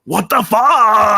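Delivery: high, loud, slow what the fuck; indignant outburst. Acted. Bigfoot protesting the cut, slow indignant outburst. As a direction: drag it out in protest.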